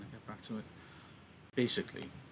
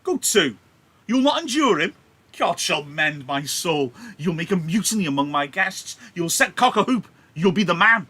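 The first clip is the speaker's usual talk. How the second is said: high, loud furious scolding; angry Capulet; 8 semitones higher, 13 dB louder than usual. Acted. Capulet scolding hot-headed Tybalt at his party, shouting angrily. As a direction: bellow him down, outraged host.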